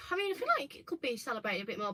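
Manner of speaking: ordinary talk, nothing marked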